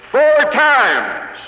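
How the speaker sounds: high, loud burst on the count; emphatic shout. Real, not acted. Speaker scolding salesmen, belting out the count. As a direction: hammer home the number. Emphatic point about lost audience.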